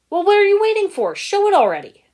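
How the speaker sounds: loud, high, fast impatient snap; angry snap. Acted. Garmadon snapping at Jay to show the feed, loud and angry. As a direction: bark the order, furious and rushed. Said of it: "Garmadon snapped".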